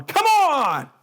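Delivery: high, loud yell at the referee; drawn-out, outraged sideline holler; mock yelling. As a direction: imitate volleyball parents heckling. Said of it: "a lot of people yelling at referees".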